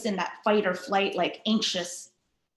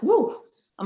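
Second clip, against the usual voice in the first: high, loud startled woo; a quick hammed-up burst of nerves; mock shaky alarm; 7 semitones higher, 5 dB louder than usual. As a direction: act out a jolt of nerves after a near miss. Imagined panic after being cut off.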